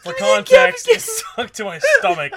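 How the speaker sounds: high, loud, helpless laughing speech; barely getting words out for laughing; cracking up. Real, not acted. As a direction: squeal it out through laughter. Cracking up while explaining the joke.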